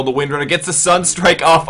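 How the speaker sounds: loud, high hype shout; excited shout. Real, not acted. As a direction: yell the surprise kill. Surprise Sunstrike.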